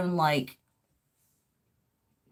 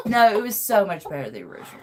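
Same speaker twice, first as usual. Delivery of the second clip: ordinary delivery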